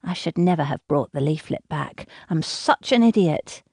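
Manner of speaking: usual speaking voice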